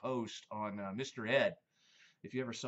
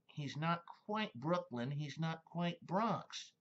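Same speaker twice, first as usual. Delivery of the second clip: slow, higher Bugs Bunny New York drawl; 5 semitones higher, slower than usual. Retold or imitated. Explaining Bugs Bunny to viewers in his voice. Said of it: "you get Bugs Bunny".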